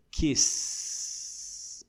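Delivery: kiss with a long drawn-out, voiceless S; "kisssss", stretching the S; unvibrating final sound. Shown as a demonstration. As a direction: hold the hiss at the end of kiss. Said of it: "não vibra nada".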